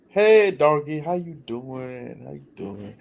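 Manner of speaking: pet-talk aside, slowing and fading away; greeting a dog. Real, not acted. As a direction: say hello to the dog, trailing into softness.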